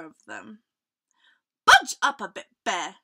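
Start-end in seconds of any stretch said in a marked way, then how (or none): 1.7-3.0 loud, mildly impatient request; tutting tiger. A narrator's reading. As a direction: tut at a crowding friend. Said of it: "Tiger tutted".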